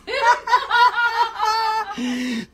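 long, high helpless laugh; high burst of laughter. Real, not acted. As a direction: break up laughing at the dog. Dog just did something funny.

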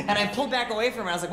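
ordinary talk, nothing marked

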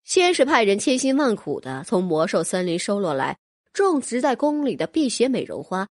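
usual speaking voice

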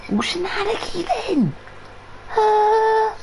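high squeaky put-on voice; squeaky mock voice. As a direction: squeak it out in a silly voice.

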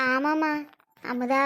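normal talking voice

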